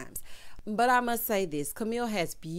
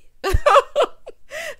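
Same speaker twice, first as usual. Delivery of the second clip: loud, high burst of laughter; high shrieking laugh; 10 dB louder than usual. Real, not acted. Laughing at own story.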